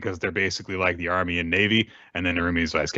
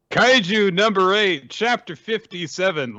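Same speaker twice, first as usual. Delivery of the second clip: title announcement; 12 semitones higher, 3 dB louder, slower than usual. Acted. Podcast opening title announcement.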